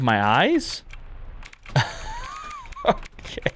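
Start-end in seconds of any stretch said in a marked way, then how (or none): 1.7-2.8 a sudden squealing high laugh; startled shriek laugh. Real, not acted. Player hears a creepy whisper and squeals with laughter. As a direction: burst out laughing in shock.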